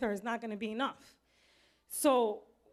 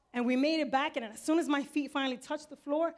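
ordinary delivery

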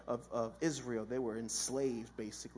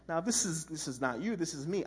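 ordinary talk, nothing marked

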